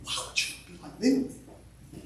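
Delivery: usual speaking voice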